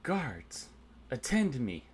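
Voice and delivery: high-pitched call to summon the guards; a restrained in-character yell; mild yell. Acted. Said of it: "she's going to yell out".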